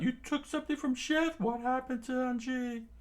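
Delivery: high, stretched protest; high anime crew voice; crew's outcry. Acted. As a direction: shout accusingly at the villain. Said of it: "The chef's crew screamed out".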